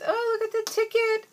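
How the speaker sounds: high-pitched delight; delighted squeal. Real, not acted. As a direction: coo over a cute find in a high voice. Delighted reaction to new stickers.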